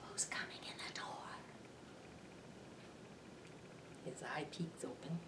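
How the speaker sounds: soft unvoiced spooky whisper; quiet spooky storyteller whisper. A narrator's reading. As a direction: hush as if kids asleep.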